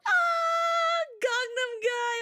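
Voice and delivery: high shouted delight; excited squeal. Real, not acted. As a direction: squeal the memory. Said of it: "squealing recall".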